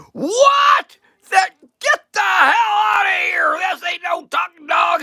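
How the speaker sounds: very high, hammed-up angry shout; furious outburst. Bartender throws out man and dog, furious outburst. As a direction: scream them out of the bar.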